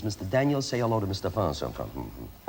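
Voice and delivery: low, trailing mumble of an introduction; mumbled introduction. Acted. Showing how names get lost in introductions, mumbled. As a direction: let it sink into a soft, wordless mumble. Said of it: "you hear a mumble".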